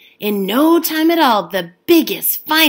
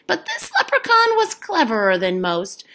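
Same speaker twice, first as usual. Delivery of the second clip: ordinary delivery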